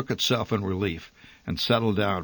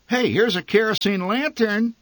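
high, loud happy find; excited mother; 13 semitones higher, 5 dB louder than usual. A narrator's reading. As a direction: announce the lantern eagerly. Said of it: "my mother said excitedly".

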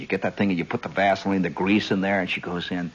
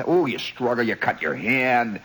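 usual speaking voice

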